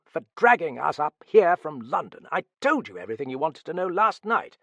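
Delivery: loud, high-pitched complaint; indignant protest. A narrator's reading. Man dragged up from London objecting, indignant. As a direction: protest hotly, hitting the key words. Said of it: "protested a voice behind him".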